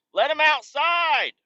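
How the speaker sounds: high, drawn-out call; high mock yell; mock cat-owner cry. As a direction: mimic owners crying out, slow and high. Mocking cat owners, calling out.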